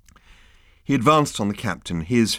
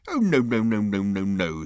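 a hint of breezy dismissal; captain shrugging off interrogation; indulgent captain. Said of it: "said the captain".

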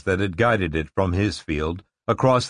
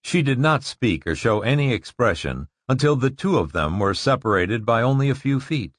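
plain reading voice

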